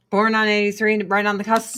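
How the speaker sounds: ordinary talk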